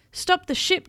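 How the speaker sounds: storybook captain's command. A narrator's reading. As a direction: call a halt like a captain. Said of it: "commanded the captain".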